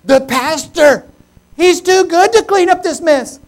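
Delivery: high, loud, hammed-up blame; exaggerated indignant accuser; mock accusation. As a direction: proclaim it like an outraged tattletale. Imagined accusers blaming the pastor.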